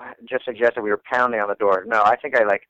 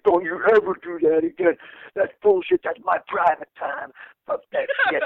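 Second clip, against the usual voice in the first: much higher, shrill imitated tirade; imitating an enraged buddy yelling; angry rant; 11 semitones higher than usual. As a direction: rant in his face like a furious friend. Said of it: "he was pissed off, like, in my face".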